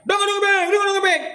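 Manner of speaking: high, whiny imitation of a complaining crowd; mocking the protesters with a high, pleading whine; mock whining plea. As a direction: mimic people begging in a high whine. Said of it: "teriak-teriak".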